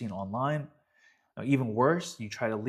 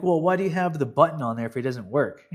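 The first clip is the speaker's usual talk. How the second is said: louder, indignant mock complaint; exasperated; 4 semitones higher, 8 dB louder than usual. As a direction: ask it as if the answer is obvious. Retold complaint to coach.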